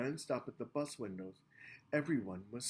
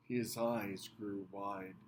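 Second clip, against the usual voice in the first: plain reading voice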